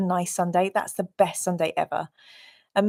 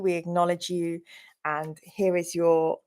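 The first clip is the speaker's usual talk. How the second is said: ordinary talk, nothing marked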